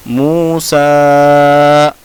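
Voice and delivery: मूसा chanted with both vowels held long; elongated recitation. Shown as a demonstration. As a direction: stretch सा of मूसा, loud and steady. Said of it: "पढ़ा कैसे जाएगा?".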